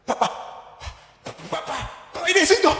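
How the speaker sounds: breathless, whispery panicked stammer; hammed-up panting plea; mock trembling. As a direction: act out arriving shaken and out of breath. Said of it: "Y llegó corriendo, temblando".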